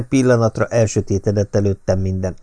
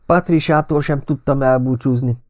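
mildly cross, slightly raised pitch; annoyed; 6 semitones higher than usual. A narrator's reading. Friend complaining as the car leaves, mildly cross. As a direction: grumble with a touch of anger. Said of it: "mondtam mérgesen".

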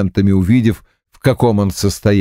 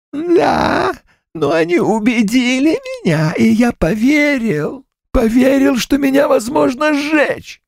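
ghost voice. Acted.

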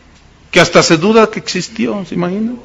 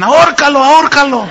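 ants egging on; 7 semitones higher than usual. Retold or imitated. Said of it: "todas las demás abajo le decían".

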